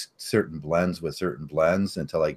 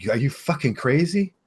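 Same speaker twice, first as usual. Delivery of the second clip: incredulous outburst; 9 semitones higher, 4 dB louder than usual. Retold or imitated. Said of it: "Everybody would just be like".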